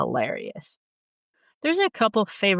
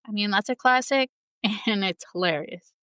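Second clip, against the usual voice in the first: ordinary delivery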